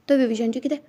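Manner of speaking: usual speaking voice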